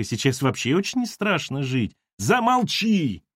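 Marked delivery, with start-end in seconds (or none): 2.2-3.2 high, slow drawn-out cry; shout. A narrator's reading. Lavrovsky shouting at Ira to stop. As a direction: stretch the cry out high in panic. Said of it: "крикнул Лавровский".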